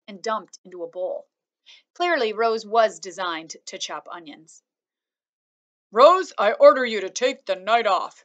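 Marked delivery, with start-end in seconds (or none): 5.9-8.2 louder, playful fatherly order; a dad joking with the robot; laughing. A narrator's reading. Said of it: "Mister Sharif laughed".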